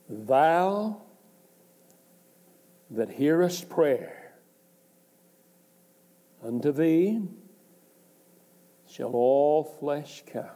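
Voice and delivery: slow, solemn reading of the verse; scripture reading. Acted. Preacher reading the psalm to the congregation, solemn. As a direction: lift the psalm words out slowly.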